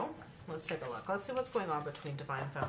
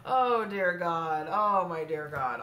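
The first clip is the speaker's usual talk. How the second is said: exasperated; 5 dB louder, slower than usual. Real, not acted. Exasperated reaction to the cards.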